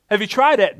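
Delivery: ordinary talk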